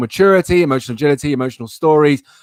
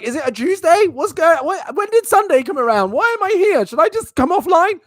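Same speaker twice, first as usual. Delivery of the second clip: much higher frantic self-questioning; mock panic. Streamer retelling his panic when nobody joined, frantic and much higher. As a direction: replay your confused panic, pitch shooting up. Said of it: "I freaked out".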